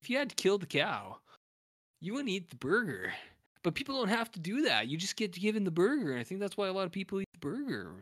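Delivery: high, dreamy laid-back stoner musing; Linklater-style musing. Retold or imitated. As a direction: muse on burgers like a spaced-out philosopher. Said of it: "he's kinda talking like that".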